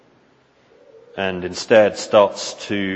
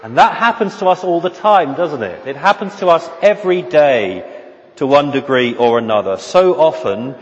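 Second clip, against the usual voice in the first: higher, louder, rising preaching; impassioned preaching; 4 semitones higher, 4 dB louder than usual. Acted. Preacher urging the congregation to keep their eyes on Jesus: impassioned. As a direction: build the fervour of the sermon.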